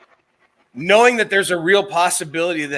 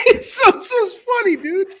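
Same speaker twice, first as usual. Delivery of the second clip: high, laughing through the words; laughing hard; 5 dB louder than usual. Real, not acted. Still cracking up about the noodle bowl, laughing words. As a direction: squeak it out mid-laugh.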